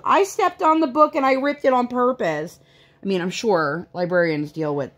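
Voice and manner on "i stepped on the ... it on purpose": mock a kid proudly announcing the crime; imitated little-girl confession, pitched way up; daughter confessing. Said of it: "doesn't walk in there and goes".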